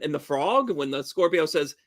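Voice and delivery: normal talking voice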